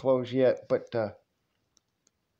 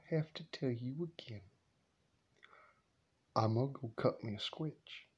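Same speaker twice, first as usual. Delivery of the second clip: much softer, whispered threat; menacing whisper; 13 dB quieter than usual. Retold or imitated. Grandma quietly threatening the grandson with a switch.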